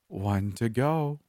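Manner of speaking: a killer gloating to the last survivor, slow and playful. A narrator's reading. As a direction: savour it like a game. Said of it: "in a playful voice".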